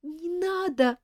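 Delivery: high, begging cry; pleading girl; frightened plea. Acted. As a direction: plead with the king in fear. Terrified girl begs king.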